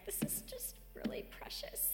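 a high, unvoiced whisper of delight; breathless whisper. Speaker at her mailbox moved by the mail, whispering high. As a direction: breathe it out, touched by something small. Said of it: "I'm like".

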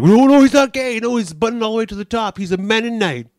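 high, loud ribbing voice; mock jokey coworker. Coworker joking the buttoned shirt means Mennonite, loud. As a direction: rib him loudly.

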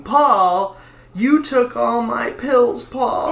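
imitating a wife's tearful yell through a door; crying shout. Wife pounding on the bathroom door, yelling in tears. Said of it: "all I'd hear all night was".

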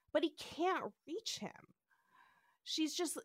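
ordinary delivery

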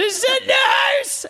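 put-on high, loud, slow old-woman cry of alarm; a frantic old lady calling for help; panicked old woman. Prank call to the pest line, high panicked old woman. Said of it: "pretending to be an old woman".

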